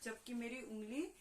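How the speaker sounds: usual speaking voice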